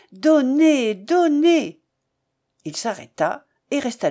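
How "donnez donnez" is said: raised, higher orator's plea; fervent exhortation. A narrator's reading. Young orator exhorts the rich in his speech, fervently. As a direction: urge it loudly, each word ringing out.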